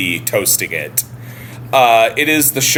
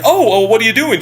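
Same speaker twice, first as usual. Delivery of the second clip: higher, louder, lightly voiced Larry, falsely casual; a light take on a man feigning casual interest; retelling Larry walking into the girl's room; mock innocent Larry; 11 semitones higher, 4 dB louder, faster than usual. Larry finds girl in room.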